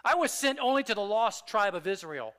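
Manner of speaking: emphatic, dismissive and loud; stern rebuff. Shown as a demonstration. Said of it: "If I say it like this".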